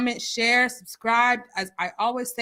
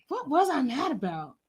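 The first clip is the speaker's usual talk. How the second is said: normal talking voice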